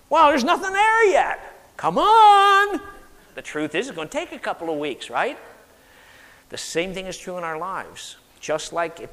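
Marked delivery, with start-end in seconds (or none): 0.1-2.8 loud, high-pitched exasperation; mock impatient complaint. Gardener checking newly planted seeds, loud mock impatience. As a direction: whine loudly at the bare soil.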